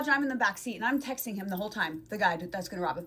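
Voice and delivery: ordinary talk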